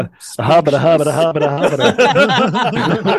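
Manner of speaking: loud, high, silly hobbit voice breaking into laughs; hammed-up hobbit chant, slowing at the end; playful mock hobbit voice. Goofy voice while laughing.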